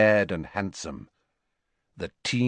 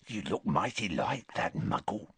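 hushed, softer rough voice; whispering; 3 semitones lower, 9 dB quieter than usual. Acted. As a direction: keep it low and suspicious. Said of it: "whispered Morphin".

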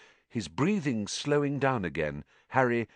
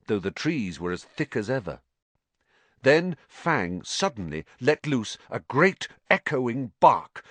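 ordinary delivery

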